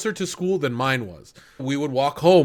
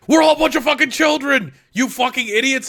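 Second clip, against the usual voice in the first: higher, louder, fast furious yell; mock angry shout; 11 semitones higher, 5 dB louder, faster than usual. Yelling at the high school kids, furious and fast. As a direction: scream it at the idiot kids like a fed-up teen. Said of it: "And I was just like".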